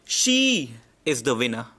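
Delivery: "She" punched hard, rest of "She is the winner" dropped; stress on She. Shown as a demonstration. Teacher shows the class the stress on "She". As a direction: lean on "She" as if picking her out of many. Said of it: "She is the most important word".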